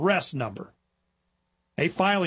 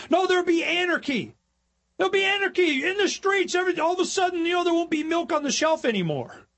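mock alarmist; 11 semitones higher than usual. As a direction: squeal like a scared statist. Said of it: "You go".

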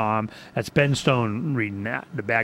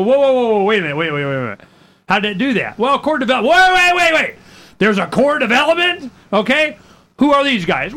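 incredulous interruption; 8 semitones higher, 4 dB louder than usual. Retold or imitated. Host interrupting guests he grills.

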